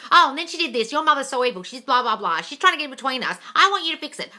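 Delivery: lightly voiced complaining girlfriend; mock whiny complaint. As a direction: whine it like a nagging partner. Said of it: "Then you say to your S P".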